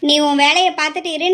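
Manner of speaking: normal talking voice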